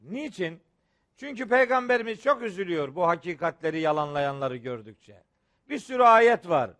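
ordinary talk

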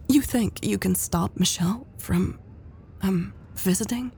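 soft, halting question; quiet, uncertain asking; hesitant. A narrator's reading. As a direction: tread carefully, pausing mid-question. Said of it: "Elle asked hesitantly".

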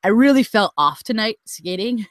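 ordinary talk

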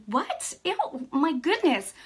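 high, drawn-out incredulous recoil; exaggerated disbelief and disgust; appalled disgust. Reacting to stupidity on TV.

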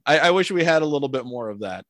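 usual speaking voice

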